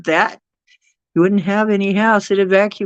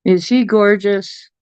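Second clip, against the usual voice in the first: normal talking voice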